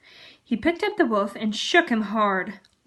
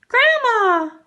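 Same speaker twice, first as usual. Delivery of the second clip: much higher, louder call; 13 semitones higher, 9 dB louder than usual. Acted. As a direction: call into the empty house. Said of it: "she called".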